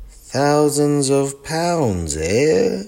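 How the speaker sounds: slow, high, drawn-out disbelief; amazed. Acted. Gran marvelling at the expert's price, slow and amazed. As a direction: savour the sum in wonder. Gran hears the bears' value.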